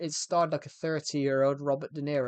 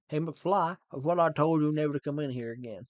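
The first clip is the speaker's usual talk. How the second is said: louder Texan movie-bully voice; put-on Texas accent; 5 dB louder than usual. Bully to McFly in Back to the Future, Texas accent. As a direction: taunt in a mock Texan accent. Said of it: "but it was in Texas".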